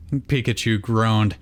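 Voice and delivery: plain reading voice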